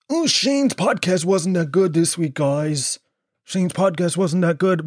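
high, put-on complaining listener voice; mocking whiner. Mocking listeners who complain about podcast.

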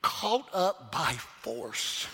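deliberate, drawn-out stress on each word; emphatic definition. Real, not acted. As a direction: spell out "caught up by force" with slow weight.

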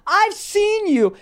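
imitating a man near tears, loud and very high; slow, high mock-hysterical accusation; hyped-up. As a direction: wail the accusation, drawn out. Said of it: "he's so hyped that he starts to cry".

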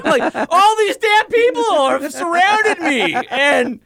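high, slow laughing complaint; laughing exasperation. Real, not acted. Host laughing at his nosy neighbours, high and slow. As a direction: laugh it out in disbelief. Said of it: "laughing exasperation".

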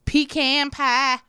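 hammed-up drawn-out Southern twang; Southern accent. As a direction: stretch it out in cartoon Southern. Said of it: "It's like Southern".

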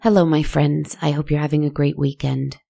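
ordinary delivery

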